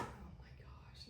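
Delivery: whispered dismay, slowed down; inner whisper. Retold or imitated. Mother realizing her mistake, whispered dismay. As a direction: breathe it to yourself in horror. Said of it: "I'm like thinking".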